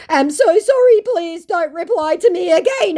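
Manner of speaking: high, loud, whiny put-on scammer voice; mock pleading scammer. Reading the scammer's plea in a whiny mock voice. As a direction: ham up a begging scammer.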